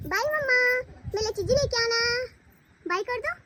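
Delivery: put-on cute babyish voice, speaking as the toddler. Mother narrating as her baby to viewers, in a cute baby voice. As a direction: voice the baby's thoughts, playful and sweet.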